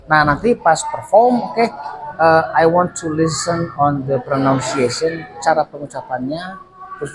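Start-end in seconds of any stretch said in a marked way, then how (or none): none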